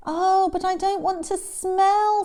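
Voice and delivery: put-on high, loud childlike complaint; whining elephant. Elephant to Hippo about smelling, high whine. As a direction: wail it in mock dismay.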